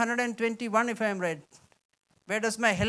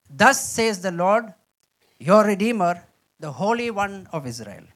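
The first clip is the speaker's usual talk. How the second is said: solemn scripture reading; 6 dB louder than usual. Shown as a demonstration. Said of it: "Let me read this".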